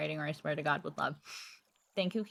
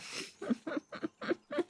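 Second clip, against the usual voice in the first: breathy laugh; 11 semitones higher, 10 dB quieter than usual. Real, not acted.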